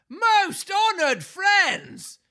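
high, slow, grand showman announcement; pompous ringmaster. Acted. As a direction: puff yourself up like a circus ringmaster. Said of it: "in a loud, pompous voice".

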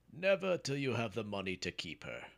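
stern father laying down terms; firm refusal. A narrator's reading. Father refuses son's marriage request.

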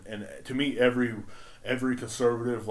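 ordinary talk

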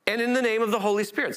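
preacher voicing Jesus sending out disciples; preaching at full force. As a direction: preach it with ringing authority. Reads Jesus' commission, preaching.

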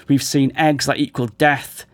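ordinary talk, nothing marked

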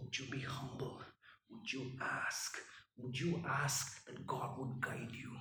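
soft, unvoiced, intimate; gentle whisper. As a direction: invite them tenderly. Jesus' call voiced in whisper.

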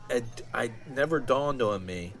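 ordinary talk, nothing marked

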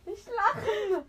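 loud scolding. Real, not acted. Friend scolds her to keep still and not laugh, loudly.

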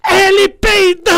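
high, slow, loud shriek through laughter; laughing shriek. Retold or imitated. Girl telling the teacher who farted, laughing shriek. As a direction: squeal it while cracking up.

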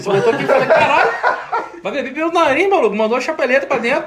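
can't stop laughing while telling it. Real, not acted. Laughing at friend who sniffed the gin.

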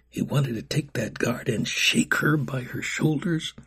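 ordinary delivery, nothing marked

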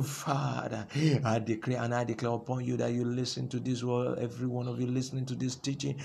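prayer. Acted. Pastor praying over his listeners, soft and reverent. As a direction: pray quietly before the congregation.

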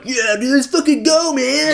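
high, loud, slow bro cheer; hyped gym bro. Retold or imitated. Mocking a friend hyped on pre-workout, loud gym-bro cheer. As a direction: pump yourself up like a gym bro.

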